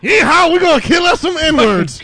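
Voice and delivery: loud high hillbilly whoop. Acted. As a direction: whoop it like a rowdy cowboy. Mock redneck cheer.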